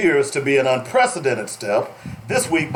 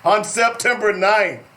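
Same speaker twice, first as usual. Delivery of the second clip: ordinary talk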